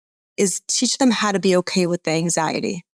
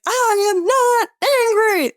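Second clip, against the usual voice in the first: much higher, slow, loud shout denying anger; angry teacher yell; 14 semitones higher, 3 dB louder than usual. Retold or imitated. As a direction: bellow it as a furious teacher. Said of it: "He's like".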